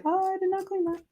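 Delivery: mock startled realization; mock dismay. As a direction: play the oops moment, lightly. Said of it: "I'm like".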